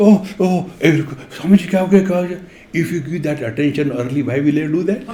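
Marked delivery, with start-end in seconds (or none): 0.1-2.4 fussing onlookers. Retold or imitated. People fussing over a pretended fall.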